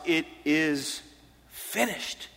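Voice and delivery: soft, slow delivery with a pause before the last word; hushed quoted declaration. Retold or imitated. Preacher first quoting Jesus' words from the cross to listeners, hushed. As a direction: let the last word drop away softly. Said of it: "Jesus said from the cross".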